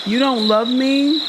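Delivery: high, slow, plaintive mock pleading; imitating Angela. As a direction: whine it like a lonely wife. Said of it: "imitating Angela again".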